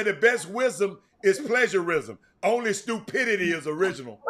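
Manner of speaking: too fast. Real, not acted. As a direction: rattle the saying off without pausing. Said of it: "You said it too fast".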